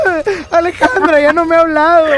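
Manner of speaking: hammed-up crying whine, wailing at the end; fake-cry like a heartbroken boy; mock sobbing. Radio host mocking a crying caller. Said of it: "estaba llorando diciendo".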